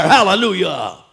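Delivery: ordinary delivery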